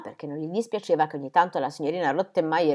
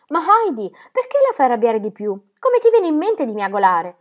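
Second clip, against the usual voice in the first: reproachful; 6 semitones higher, 6 dB louder than usual. A narrator's reading. Clara steps in, reproaching Heidi.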